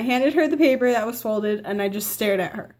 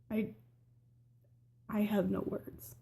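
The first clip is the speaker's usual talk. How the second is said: much softer, almost swallowed words; stunned dad; 14 dB quieter than usual. Retold or imitated. Stepdad hearing the pregnancy news, stunned and quiet. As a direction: say it like you're too stunned to speak. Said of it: "my dad was kinda like".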